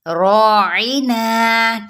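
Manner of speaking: रॉइना very slow with a heavy ra; tajweed demo. Shown as a demonstration. Teacher showing students the heavy ra, very slow. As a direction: draw out रॉइना with a full ra. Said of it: "रॉ हम पुर पढ़ेंगे".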